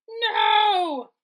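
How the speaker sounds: high, loud cry of alarm. A narrator's reading. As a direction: cry out in fright. Crowd sees him falling.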